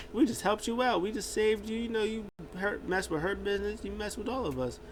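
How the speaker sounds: high-pitched mock bragging; boastful wrestler. Wrestler boasting to his teammates, high and cocky.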